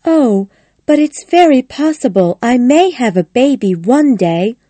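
lightly voiced lady of the house; a slightly lifted character voice for the lady; Connie. Connie replying to Missus Bolton about a baby, lightly voiced lady.

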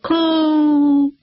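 high, drawn-out bird call; a long crane cry; crane caw. A narrator's reading. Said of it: "The bird looked down and cried".

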